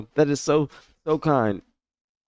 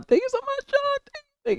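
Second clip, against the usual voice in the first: extremely high, squeaky tearful voice; overcome, tiny high-pitched gratitude; about to cry. Real, not acted. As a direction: squeak it out through happy tears. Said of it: "I'm gonna cry".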